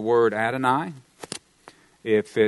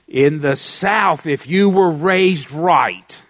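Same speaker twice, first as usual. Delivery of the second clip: slow, louder Southern drawl; 5 dB louder, much slower than usual. Shown as a demonstration. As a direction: stretch the words out like a Southern preacher. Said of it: "See, I can talk like that".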